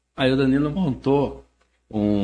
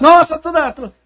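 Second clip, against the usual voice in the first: excited fan; 12 dB louder than usual. Retold or imitated. Fan gushing at the singer.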